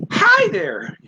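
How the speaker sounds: excited, high-pitched loud greeting. Shown as a demonstration. Teacher showing an excited English greeting, excited. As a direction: burst out brightly in a high, loud voice. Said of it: "But what if you're excited".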